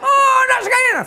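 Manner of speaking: very high, hammed-up wail; loud mock outcry; imitated joke cry. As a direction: shriek the punchline in a put-on high voice. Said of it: "somebody hits her".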